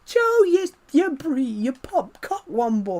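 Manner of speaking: high, slow put-on girl's voice, stumbling over the words; girl in my class. Classmate accusing Joe of popping the balloon, high mock girl's voice. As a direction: squeak it out like an upset classmate. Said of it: "a girl in my class went".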